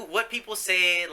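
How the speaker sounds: normal talking voice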